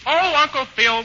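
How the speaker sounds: ordinary talk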